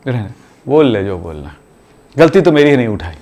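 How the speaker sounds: low, soft, hurt retort; a mother grumbling quietly that it's always her fault; sulky mother. Retold or imitated. Mother answers her blaming child, low sulky grumble. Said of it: "mummy बोलती".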